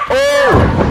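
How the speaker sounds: sudden high yelp of shock; shocked gasp. Real, not acted. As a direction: react to a brutal hit. Dramatic wrestling move.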